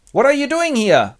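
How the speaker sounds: high, loud demand; nagging wife. Acted. Wife catches husband idling, high and scolding. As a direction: challenge him in a scolding high voice.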